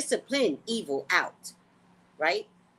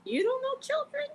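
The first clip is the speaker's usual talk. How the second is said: high incredulous squeak. Real, not acted. Asking the audience, incredulous and high. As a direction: pitch it way up in disbelief.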